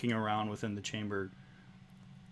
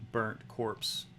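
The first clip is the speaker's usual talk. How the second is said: ordinary delivery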